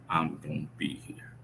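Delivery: hushed, breathy whisper of the promise; a soft whispered promise to himself. Real, not acted. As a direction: say it under your breath as a vow. Repeats promise, then whispers it.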